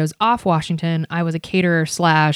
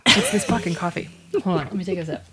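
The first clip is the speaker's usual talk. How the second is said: usual speaking voice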